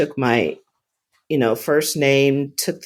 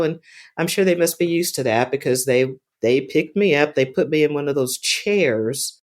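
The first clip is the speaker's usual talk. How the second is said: ordinary talk, nothing marked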